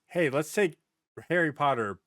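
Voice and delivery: usual speaking voice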